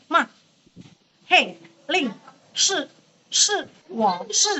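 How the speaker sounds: "Ma", "hei", "ling", "shi" jerked downward, each short; snapped fourth tone. Shown as a demonstration. Teacher showing tone four is easiest, snapped syllables. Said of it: "Dia tinggal nyentak aja".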